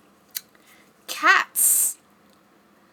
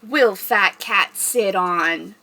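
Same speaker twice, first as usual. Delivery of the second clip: ordinary delivery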